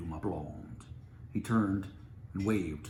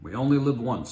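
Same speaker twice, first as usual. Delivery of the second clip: loud, raised, dashing declaration; heroic flourish; 6 semitones higher, 12 dB louder than usual. Acted. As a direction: play the fearless hero. Captain's heroic line.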